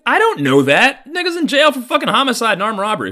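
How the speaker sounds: loud, high-pitched retort; incredulous protest. Retold or imitated. Son arguing with mom about his dad, loud incredulous protest. Arguing back at mom's reassurance.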